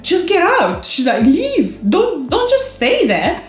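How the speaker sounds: friend urging. Retold or imitated. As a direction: push her out the door, high and insistent. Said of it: "And then she's like".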